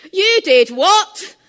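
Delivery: slow, high, loud furious shout. Retold or imitated. Liz shouting at her fiancé across the kitchen, furious. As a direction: explode at him in disbelief. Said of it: "shouted Liz".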